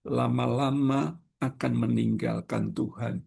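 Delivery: ordinary talk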